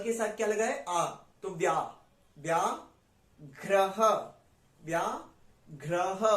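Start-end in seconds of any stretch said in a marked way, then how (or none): 2.4-6.2 slow "व्याघ्रः" split into vyaa, pause, ghra-ha; blended consonants demo. Shown as a demonstration. Teacher joining half va with ya, "व्याघ्रः" in slow parts. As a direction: stretch "व्या" in "व्याघ्रः", then finish with "घ्रः". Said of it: "य को साथ pronounce कर देंगे".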